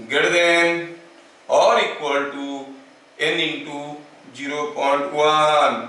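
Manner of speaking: drawn out, piece by piece with pauses; slow dictation. Shown as a demonstration. Teacher giving students the relation, slow dictation. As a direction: spell out the formula slowly for note-takers.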